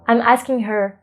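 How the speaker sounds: ordinary talk, nothing marked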